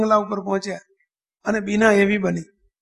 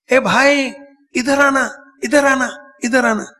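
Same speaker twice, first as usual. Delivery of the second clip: imitating an old woman's loud call; raised, higher voice, hailing someone over; 4 semitones higher, 4 dB louder than usual. Old woman hails a passer-by from her cot, calling out. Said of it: "બેઠા થઈને એમણે બૂમ પાડી".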